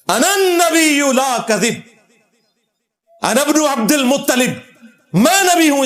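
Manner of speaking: re-enacted battlefield cry, pitched well up; battle proclamation. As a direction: proclaim it high, stretching the words.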